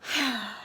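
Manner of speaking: queen sighing wearily; weary sigh. Acted. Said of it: "Queen Almond sighed".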